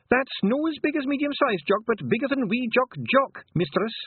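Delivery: very high Scottish pixie voice; a proud wee Feegle. A narrator's reading. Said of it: "said Not as big".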